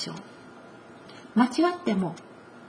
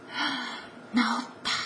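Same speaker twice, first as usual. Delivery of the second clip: hushed relief; 10 dB quieter than usual. Acted. Woman realizes she's healed.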